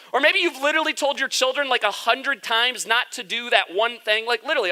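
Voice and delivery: ordinary talk, nothing marked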